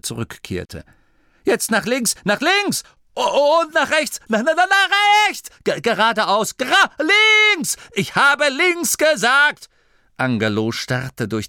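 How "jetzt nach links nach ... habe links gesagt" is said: very high, stammering panicked shouts; frantic commands. A narrator's reading. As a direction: yell corrections, tripping over the words. Frantic contradicting steering orders.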